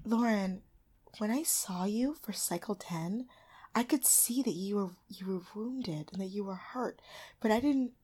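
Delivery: hushed, whispery, solemn imitation; Tyra. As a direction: imitate overdone, hushed emotional concern. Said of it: "Tyra's like".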